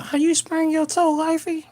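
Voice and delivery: very high, loud, incredulous squeal; reacting to a viewer's sprained toe in high-pitched disbelief; squeaky surprise. Real, not acted. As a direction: shoot up to a squeaky falsetto in disbelief. Reacting to a chat message.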